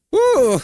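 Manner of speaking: high excited whoop; excited woo. Real, not acted. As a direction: let out a hyped cheer. Hyped reacting to the music.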